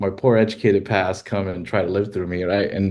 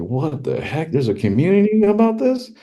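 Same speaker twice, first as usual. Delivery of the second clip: amazed surprise; 9 semitones higher than usual. Retold or imitated. Retold surprise at million views.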